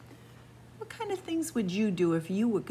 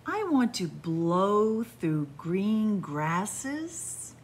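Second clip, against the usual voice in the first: slow, lilting storybook read; reading to children; slower than usual. Acted. Storyteller reading the windy-day book to kids, lilting. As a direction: savour each image for little listeners.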